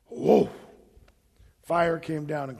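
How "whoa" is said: amazed whoa. Retold or imitated. Fire falls on the altar.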